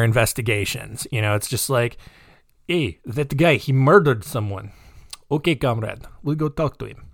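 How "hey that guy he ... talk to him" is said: casual mock Russian-accented cops; comic Russian accent, unbothered officers conferring; hammed-up Russian policeman. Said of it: "about Russian police is they are pretty surface level".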